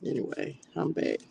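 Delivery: normal talking voice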